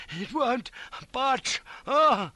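high, effortful gasping words; straining, ending in a loud cry. A narrator's reading. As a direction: push each word out while heaving. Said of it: "straining away".

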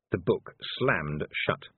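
ordinary delivery

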